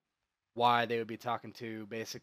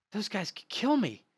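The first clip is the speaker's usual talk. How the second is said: high, loud panicky protest; backing away from danger, voice jumping up; scared; 10 semitones higher, 7 dB louder than usual. Acted. Fern scared of the soldiers.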